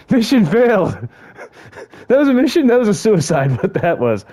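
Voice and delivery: high, loud amused outrage; high giggly mock outrage; laughing disbelief. Real, not acted. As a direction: crack up at your own failure. Mocking his own failure.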